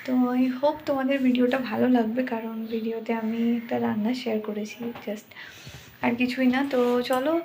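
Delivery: hushed voice so a sleeper stays asleep; speaking softly. Real, not acted. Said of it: "আর আস্তে কথা বলছি এই কারণেই".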